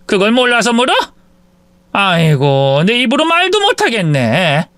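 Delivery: high, furious scolding; mother-in-law scolding her cheating daughter-in-law. Acted. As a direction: let her have it.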